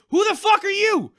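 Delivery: loud, high shout of confused outrage; imitating yelling at the TV; mock bewildered shout. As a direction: yell it at the TV in disbelief. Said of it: "I'm looking up going".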